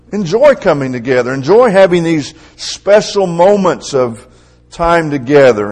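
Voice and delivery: high, loud preaching voice; fervent preaching. Acted. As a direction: proclaim it to the whole congregation with force. Preacher rises to full force.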